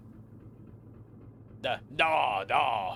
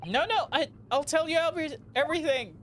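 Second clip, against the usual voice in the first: high, louder frantic begging; panicked pleading; 11 semitones higher, 3 dB louder, faster than usual. Pretend. Suspect begging the officer, high panic. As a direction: beg to be spared in panic.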